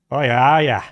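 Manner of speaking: lightly imitated Aussie local; a touch of broad Australian accent. Imitating a gas station guy, Aussie accent. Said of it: "That's the most Aussie thing I've heard".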